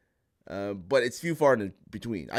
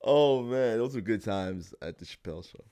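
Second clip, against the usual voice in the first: normal talking voice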